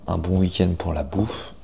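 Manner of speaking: ordinary talk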